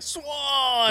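sudden high yell; shout. Retold or imitated. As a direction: yell it out in alarm. Characters yelling at swan.

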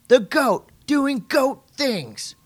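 high, slow, loud drawn-out call; commentator call. Retold or imitated. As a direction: stretch it like a big broadcast moment. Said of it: "I had that call".

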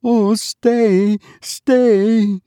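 put-on high, pleading mother begging her child to stay; imploring mother. A narrator's reading. Grieving mother to her dead child, high imploring plea. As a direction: hold on to him with your voice. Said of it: "implored the mother".

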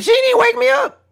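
high, fast, outraged squeal; indignant squeal. Real, not acted. He squeals that his wife let him sleep. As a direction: shriek it in disbelief.

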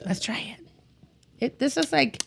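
soft unvoiced whisper. Real, not acted. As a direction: breathe the words out, no voice. Quiet tasting aside to camera.

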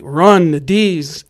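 loud, drawn-out warning yell; retold yell. Lookout warns painter over the phone, retold yell. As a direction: imitate a lookout yelling a warning. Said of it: "he just yells over the phone".

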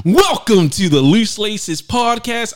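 high, loud, drawn-out show opener; stretched, booming podcast welcome; hyped intro. Acted. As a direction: announce the show like a host.

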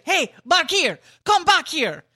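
high, loud, punchy shout to someone; calling out. Acted. Host teasing coworker Bakir, loud call-out. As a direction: call him over loudly, playing on his name. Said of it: "because I'd say".

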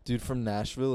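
normal talking voice

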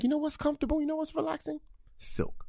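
breathy hushed reveal. Retold or imitated. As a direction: reveal it in a soft hush. Retold thought, very quiet.

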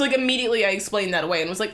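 ordinary talk

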